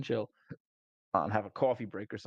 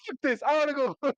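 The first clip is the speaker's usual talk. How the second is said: much higher and louder, fed-up whine; whiny high complaint; 6 dB louder, faster than usual. Retold or imitated. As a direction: whine like a scared kid wanting home. Traumatized character refuses to follow.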